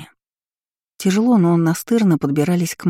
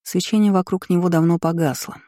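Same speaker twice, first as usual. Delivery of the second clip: plain reading voice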